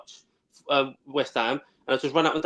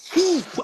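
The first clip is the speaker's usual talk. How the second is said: a high, sharp intake of breath in alarm; shocked gasp. Retold or imitated. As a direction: gasp as the car lurches. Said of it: "He went".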